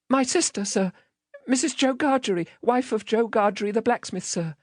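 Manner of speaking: put-on small, high-pitched child's answer; frightened child. Child answering the threatening man, timid. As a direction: answer meekly in a high little voice.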